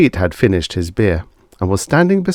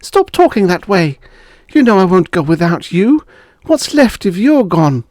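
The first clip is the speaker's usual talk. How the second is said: higher, louder pleading protest; wife refusing to leave without husband; upset wife; 12 semitones higher, 6 dB louder than usual. Acted. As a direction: fling the words at him in distress.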